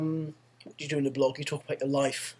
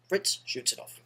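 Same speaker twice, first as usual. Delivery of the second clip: ordinary delivery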